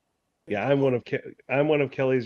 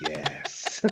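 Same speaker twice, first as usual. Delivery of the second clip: soft, voiceless hiss of delight; gleeful whispered yes; 8 dB quieter than usual. Retold or imitated. Writer relishing a gross idea, gleeful whisper. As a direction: whisper it like a secret triumph. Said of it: "and I'm like".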